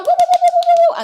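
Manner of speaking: very high, loud woo-woo cheer; cheering whoops. Real, not acted. Streamer cheering the returning chat bot, high loud whoops. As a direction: whoop like a party crowd.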